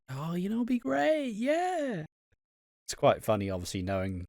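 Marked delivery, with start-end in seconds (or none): 0.1-2.0 Linda. Retold or imitated. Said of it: "She's like".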